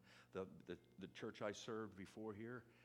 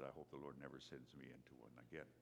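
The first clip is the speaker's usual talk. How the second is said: wry aside; 9 semitones lower, 10 dB quieter, faster than usual. Retold or imitated. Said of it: "But I also said".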